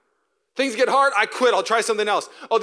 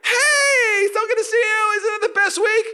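much higher, bright chirpy gushing voice; overly positive person; 6 dB louder, slower than usual. Retold or imitated. Mocking a too-positive friend, chirpy high gushing. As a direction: gush like a relentlessly cheery friend. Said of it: "so positive it makes you negative".

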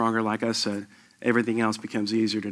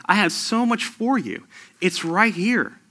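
higher, gentle beckoning voice, slowing at the end; inviting Jesus; 8 semitones higher than usual. Retold or imitated. Pastor voicing Jesus inviting the congregation, higher and gentle. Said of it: "Jesus is actually sitting right here saying".

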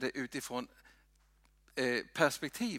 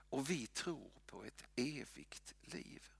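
soft, slow whisper; whispered creed; 11 dB quieter than usual. Real, not acted. Preacher quotes the creed softly to the congregation. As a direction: breathe the words of faith.

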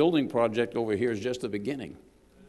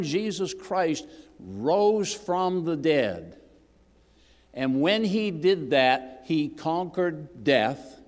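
slow, loud, drawn-out proclamation; fervent preaching; 3 semitones higher, 3 dB louder, slower than usual. Acted. Preacher to his flock, building slow and loud about Christ. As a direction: lean on each phrase and let it ring.

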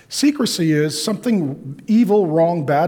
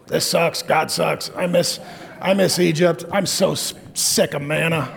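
mock grumbling. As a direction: whine like a tired Israelite sick of the desert. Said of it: "that was the verbal processing part".

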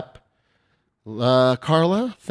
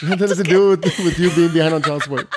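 higher and louder through laughter; laughing; 6 semitones higher, 5 dB louder than usual. Pretend. Joining the mock, laughing.

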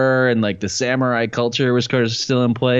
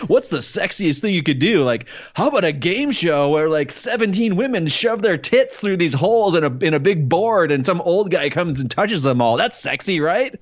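high, bouncy, put-on enthusiasm; mock eager pitch; 7 semitones higher than usual. Imitating someone pitching a racy game show, mock eager pitch. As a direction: pitch it brightly, mockingly keen, voice up high.

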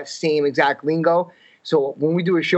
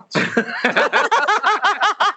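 a real high laugh breaks out; delighted high-pitched laugh; high burst of laughter. Real, not acted. Laughing at being pegged.

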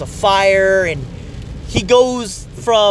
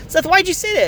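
high, incredulous ask; baffled question; 10 semitones higher than usual. Retold or imitated. Dad asks son Seth why, high and incredulous. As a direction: question the kid in disbelief. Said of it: "I was like".

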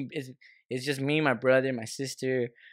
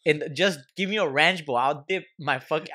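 ordinary talk, nothing marked

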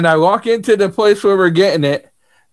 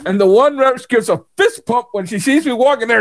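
normal talking voice

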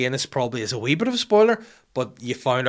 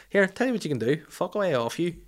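higher-pitched comic dismissal; mock telling-off; 7 semitones higher than usual. Boss telling detective to clear off, mock exasperation. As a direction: send him packing in a hammed-up high voice.

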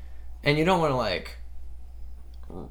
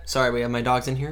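ordinary delivery

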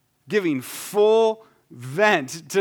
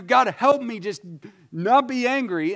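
pleading prayer; 7 semitones higher, 3 dB louder than usual. Retold or imitated. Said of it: "what we wanna do".

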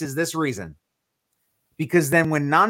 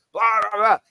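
higher, garbled blah-blah babble; mock arguing noise; 4 semitones higher, 3 dB louder than usual. People arguing on live streams, mock babble. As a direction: imitate noisy livestream bickering.

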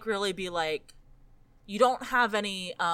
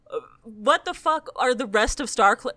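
ordinary talk, nothing marked

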